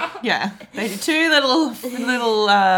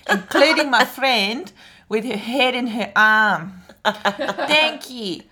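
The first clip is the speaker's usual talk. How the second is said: put-on playful South African accent, laughing; 3 semitones higher than usual. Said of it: "extra South African accent".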